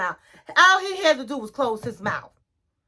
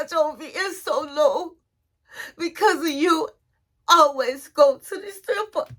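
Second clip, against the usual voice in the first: higher, teary pleading, partly mocking; imitated crying wife; 4 semitones higher than usual. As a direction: whine it out like she's sobbing at him. Yara crying to Jovi.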